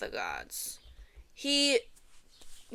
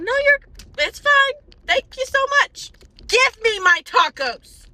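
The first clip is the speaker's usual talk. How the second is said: sugary polite brush-off; 12 semitones higher, 10 dB louder than usual. Retold or imitated. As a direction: gush thanks, then firmly ask for the food. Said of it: "I said".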